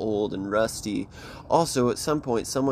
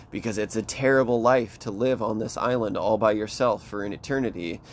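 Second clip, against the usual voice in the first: ordinary talk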